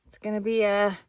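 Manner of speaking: usual speaking voice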